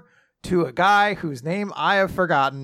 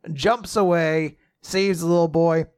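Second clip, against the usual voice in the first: usual speaking voice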